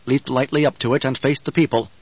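ordinary delivery, nothing marked

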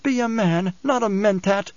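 high, understated snarl; snarled challenge. A narrator's reading. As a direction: taunt him sharply in a high voice. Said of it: "she snarled".

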